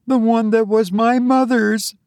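put-on high, upset girl's voice; distressed young woman. A narrator's reading. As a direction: lament it in a very high girlish pitch. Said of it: "said Olive".

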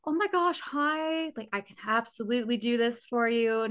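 chirpy service-desk greeting; customer service voice. Shown as a demonstration. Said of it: "customer service".

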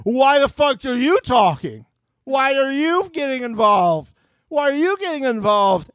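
high-pitched, slow indignant mock yell; mock outraged yell. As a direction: squeal the question up high like an offended reality star. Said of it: "And she's like".

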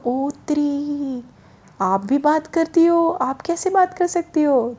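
high, drawn-out astonishment; wide-eyed disbelief, pitched well up; amazed boy. Acted. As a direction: gasp in wonder at a talking statue, stretching the words. Boy amazed that idol talks.

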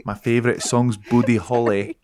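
lilting Scottish character; Scottish accent. Pretend. [name] shares favorites in character, Scottish accent. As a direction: announce it as a put-on Scot. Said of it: "totally turned into someone".

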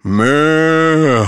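long drawn-out cow moo. Acted. Joke teller voices a cow at heaven's gate, mooing. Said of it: "So the second cow comes up".

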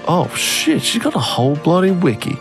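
normal talking voice

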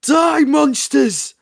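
high, slow, furious scream. Acted. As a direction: scream it like a crazed soldier. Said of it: "screamed the man".

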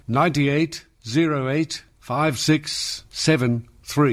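slowed down, each digit separated; slow, spelled-out digits. Shown as a demonstration. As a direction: read the number out slowly, digit by digit. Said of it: "Got it?".

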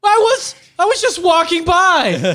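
high, pleading excuse; whiny bystander. Pretend. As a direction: plead innocence in a whine.

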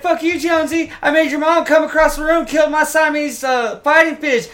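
very high, loud character squeal; Shorzy. Retold or imitated. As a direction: chirp a rival in a put-on high voice. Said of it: "he's like, he's like".

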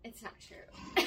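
slow, breathy, laughing; laughing whisper. Real, not acted. Laughing admission.

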